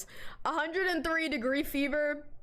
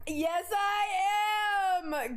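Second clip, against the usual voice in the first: excited shout; 6 semitones higher, much slower than usual. Real, not acted. Chat asks feeling better.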